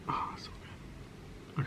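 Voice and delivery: breathy whisper of delight. Real, not acted. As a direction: whisper it, savoring the food. Whispers after first bite.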